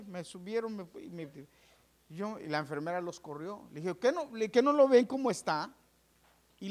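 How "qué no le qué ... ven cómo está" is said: loud, high protest at the staff; patient scolding the staff on the nurse's behalf, indignant; indignant outburst. Retold or imitated. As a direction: demand they see how bad he is. Defending him against the staff.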